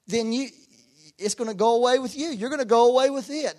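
loud, high, driving sermon voice; preaching at full force. Real, not acted. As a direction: drive the warning home to the congregation.

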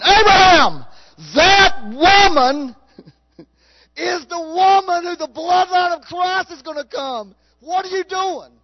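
high, loud, drawn-out divine rebuke, stretching that woman slowly; God rebuking. Acted. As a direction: call down from heaven in a booming scold. Preacher voices God rebuking Abraham.

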